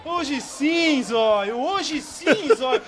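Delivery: high, loud chant breaking into laughter; laughing taunt. Real, not acted. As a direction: tease the player through laughs. (provocação rindo)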